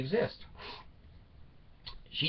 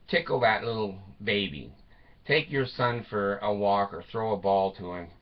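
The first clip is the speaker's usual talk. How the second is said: normal talking voice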